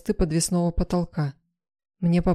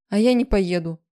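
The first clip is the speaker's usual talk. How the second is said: firm refusal; 2 semitones higher, 5 dB louder than usual. A narrator's reading. Heroine refuses to be driven away.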